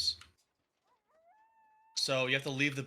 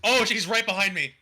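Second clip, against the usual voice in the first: alarmed cry, higher and louder; panicking gamer; 8 semitones higher, 12 dB louder than usual. Real, not acted. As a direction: panic as he closes in. Killer approaches in horror game.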